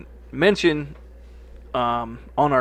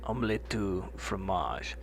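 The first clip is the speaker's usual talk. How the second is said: slow, softer put-on French accent on "Omelette du fromage"; 3 semitones lower, 8 dB quieter, slower than usual. Shown as a demonstration. Asked to repeat it with the accent, slow French accent. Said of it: "say it again with your accent".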